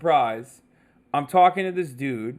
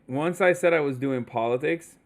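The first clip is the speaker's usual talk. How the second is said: ordinary delivery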